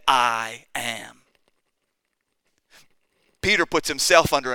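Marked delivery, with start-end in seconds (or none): 0.1-1.2 two words set down low and firm; bold declaration. Retold or imitated. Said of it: "Jesus with great boldness looked to him and said".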